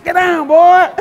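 high, loud mock yell of the wife. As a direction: shriek at him to get down. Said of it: "Kathy woke up and grabbed me".

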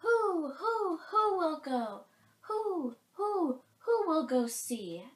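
high, loud, drawn-out hoots; hooting owl. A narrator's reading. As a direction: stretch each "who" into an owl's hoot. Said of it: "asked the Owl of Many Questions".

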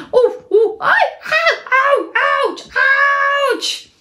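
high, laughing ouches and groans; playful pained yelps; squeezed groans. Acted. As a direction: squeal as you get squashed. Squeezing into the mitten.

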